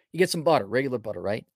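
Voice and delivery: normal talking voice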